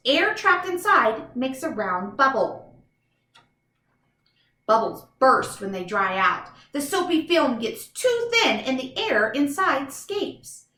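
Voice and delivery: ordinary delivery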